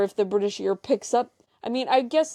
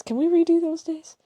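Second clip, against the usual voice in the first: higher, begging lilt; pleading whine; 8 semitones higher, 3 dB louder than usual. Real, not acted. To her co-host, wishing the trip back, pleading whine.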